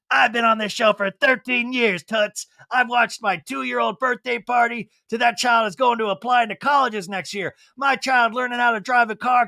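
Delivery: a hint of a grand old film star ranting; old-timey Hollywood actor. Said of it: "like an old-timey Hollywood actor".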